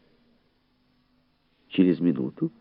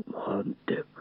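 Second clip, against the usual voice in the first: soft, voiceless hiss of warning; hushed urgent call; warning whisper; 9 dB quieter than usual. A narrator's reading. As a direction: whisper the name as a caution. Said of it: "предостерегающий шёпот Фабера".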